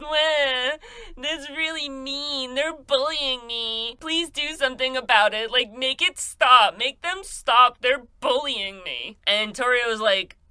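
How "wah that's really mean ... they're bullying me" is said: whiny crybaby. Retold or imitated. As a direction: play him as a big baby tattling. O'Banion whining to Torio.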